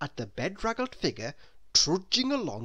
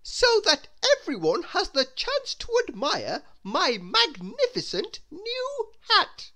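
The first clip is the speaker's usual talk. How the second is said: haughty grand lady; 5 dB louder than usual. Acted. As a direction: savour every word like a vain lady. Miss Splendid thinking.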